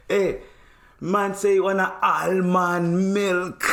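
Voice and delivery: high, slow mock Jamaican relay; drawn-out patois, passing the order on; Jamaican accent. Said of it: "He goes".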